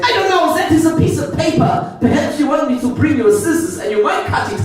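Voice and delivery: very high, prim mock German clerk; overly polite sarcastic German. Hostel clerk mocking the tourist, high prim German accent. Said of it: "he said".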